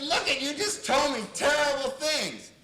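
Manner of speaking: loud, high acted comeback; heated retort. Therapist defending himself to the client, loud retort. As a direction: fire back at full volume.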